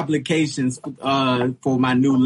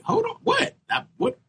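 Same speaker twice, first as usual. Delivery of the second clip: high, disbelieving squeak; retelling his confusion when the gas attendant says to wait; baffled. Retold or imitated. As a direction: replay the shock of being told to wait. Retold confusion at gas station.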